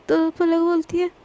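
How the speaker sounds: ordinary delivery, nothing marked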